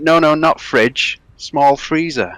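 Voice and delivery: loud, high, slow mock Polish; imitated Polish accent. Polish buyer correcting what he came to collect, imitated Polish accent. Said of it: "Very Polish".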